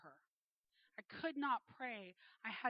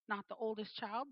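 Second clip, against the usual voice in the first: ordinary talk, nothing marked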